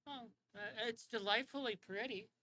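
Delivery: a delicate, prim queen admiring politely. Acted. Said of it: "And she's like".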